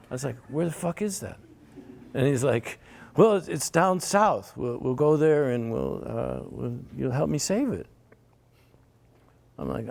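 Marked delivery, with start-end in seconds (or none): 0.5-1.4 high-pitched disbelief; baffled retort. Retold or imitated. Him asking his friend where the rainforest is, high and baffled. 3.2-7.9 high, light and vague; breezy friend. Retold or imitated. Friend vaguely explaining the trip down south, high and breezy.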